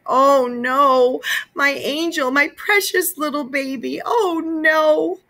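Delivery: high, wailing moan.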